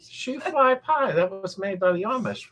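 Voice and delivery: high-pitched, amused insistence; squeaky, giggly objection; laughing protest. Real, not acted. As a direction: keep arguing on the edge of laughter. Laughing while arguing about pie.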